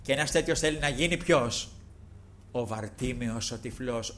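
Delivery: usual speaking voice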